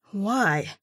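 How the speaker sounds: usual speaking voice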